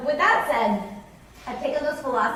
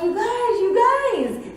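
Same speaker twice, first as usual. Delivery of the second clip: high-pitched, louder squeal of disbelief; excited screaming; 14 semitones higher, 5 dB louder, much slower than usual. Retold or imitated. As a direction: scream it to your friends, much higher. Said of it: "And I start screaming".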